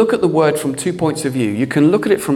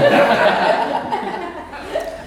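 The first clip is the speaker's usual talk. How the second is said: real laugh; 13 semitones higher, 3 dB quieter than usual. Real, not acted. Laughing at own wife story.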